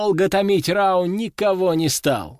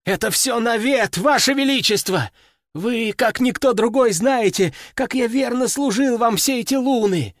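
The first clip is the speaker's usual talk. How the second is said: desperate cry; 7 semitones higher than usual. A narrator's reading. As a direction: cry out in despair. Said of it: "в отчаянии воскликнул".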